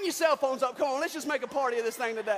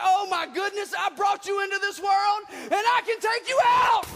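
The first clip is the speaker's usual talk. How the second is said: boiling over; 5 semitones higher, 6 dB louder than usual. Retold or imitated. Parent boiling over at the teenage daughter, building to a shout. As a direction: build the anger until it bursts.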